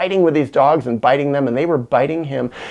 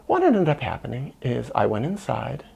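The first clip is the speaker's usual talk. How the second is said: ordinary talk, nothing marked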